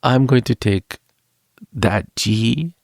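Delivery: strange put-on accent, halting between phrases; mock foreign accent with deliberate pauses; [name]'s strange accent. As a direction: state your plans in an odd mock accent. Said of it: "why I talk with this accent".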